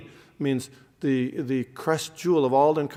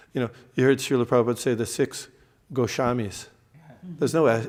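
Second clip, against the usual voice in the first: ordinary talk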